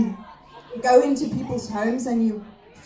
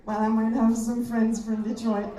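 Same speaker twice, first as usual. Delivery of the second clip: normal talking voice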